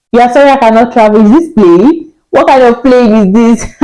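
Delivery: quick, indignant echo of the apology; incredulous retort. Retold or imitated. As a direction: throw their sorry back in playful disbelief. Indignant echo of officials' refusal.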